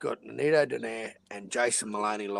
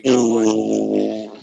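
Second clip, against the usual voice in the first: drawn out; 4 semitones lower, 12 dB louder than usual. Acted. Greets LT stretched.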